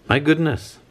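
high-pitched exclamation of shock; startled cry. A narrator's reading. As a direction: cry out as if startled into dropping your toast. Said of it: "he cried, dropping his toast".

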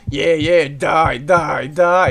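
loud chanted die; gloating shout. Real, not acted. Surgeon loudly gloating as patient Bob dies. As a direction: bellow it at him.

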